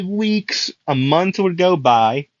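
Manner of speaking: usual speaking voice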